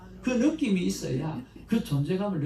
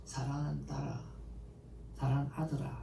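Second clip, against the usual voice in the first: put-on soft, lower loving call to a child; tender call; 3 semitones lower, 8 dB quieter than usual. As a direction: speak gently as a loving father. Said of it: "하나님께서 나를 향해서 말씀해 주시기를". (하나님이 자녀를 부르듯 부드럽고 낮게)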